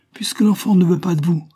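high, loud exasperated retort. A narrator's reading. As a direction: snap back at the end of your patience. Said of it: "dit Marie exaspérée".

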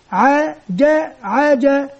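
"عا، جا،" spelled out slowly, syllable by syllable; slow spelling. Shown as a demonstration. Teacher showing pupils how to spell, slow syllables. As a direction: sound out each syllable slowly for the class. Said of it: "كيف نتهجى؟".

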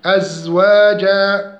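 slow أزواجا with two-beat long vowels; chanted أزواجا; natural madd. Shown as a demonstration. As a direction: hold each long a in أزواجا evenly. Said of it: "مد حركتين".

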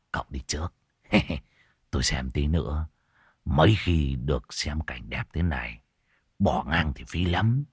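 soft, low, half-whispered; hushed aside. Acted. Old man tells the young man to go ahead, hushed. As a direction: keep it hushed so nobody hears.

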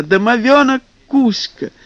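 excited mermaid. Acted. Mermaid joyfully calls.

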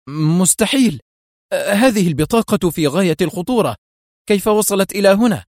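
acted astonishment and dread; shocked alarm. A narrator's reading. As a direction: exclaim in horror, pitch rising through the line. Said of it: "امتلأت ملامحه بالدهشة والفزع".

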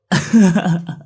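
loud hearty guffaw; burst of laughter. A narrator's reading. Old friend roaring with laughter at Tí's habit. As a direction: laugh out loud, throwing the head back. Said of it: "phá lên mà cười sặc sụa".

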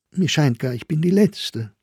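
lightly pitched, harmless remark; high, airy innocence; innocently. A narrator's reading. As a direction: toss it off guilelessly. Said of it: "sagte sie harmlos".

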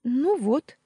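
light up with triumph; beaming. A narrator's reading. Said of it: "засияла Мариям". (сияя, радостно)

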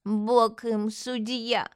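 very slow, drawn-out pious lament; plaintive, dragged-out forgiveness; old woman martyr. Acted. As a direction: play the long-suffering old lady. Old swindler plays forgiving martyr.

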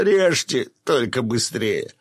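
high, loud martyr's cry; desperate surrender. A narrator's reading. Fisherman yielding his beard, desperate surrender. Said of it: "выставил подбородок и зажмурился".

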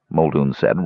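usual speaking voice

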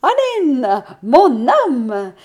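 high, loud proclaiming call; energetic, biblical. A narrator's reading. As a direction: proclaim it like a prophet. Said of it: "la voix devenant énergique, presque biblique". (voix énergique, presque biblique)

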